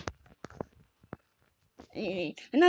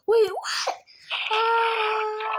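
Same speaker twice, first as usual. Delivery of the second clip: high loud wait what turning into a shriek; shocked shout; 9 semitones higher, 4 dB louder, much slower than usual. Real, not acted. Gamer reacting to a killer reveal, shocked shout. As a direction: cry out in real alarm.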